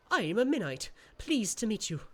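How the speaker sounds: a perky winged imp greeting; chirpy creature. Acted. Tiny creature introduces itself.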